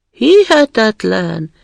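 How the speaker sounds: high, loud, slow drawn-out disbelief. Acted. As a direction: stretch it out in amazement. Robber girl disbelieving. (hitetlenkedő, elnyújtott felkiáltás)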